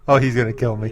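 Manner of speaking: ordinary delivery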